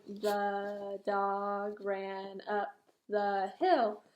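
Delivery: very slow, dragged-out reading; reading too slowly. Shown as a demonstration. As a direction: drag each word like a struggling reader. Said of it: "and he's going".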